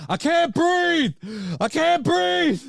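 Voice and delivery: very high, slow strangled screaming; mock screaming. Mocking a victim screaming as she chokes, very high. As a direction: squeal it out high and drawn out. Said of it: "she started screaming".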